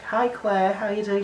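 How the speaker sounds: lightly acted high-pitched hello; chirpy greeting. Greeting colleague in acted exchange.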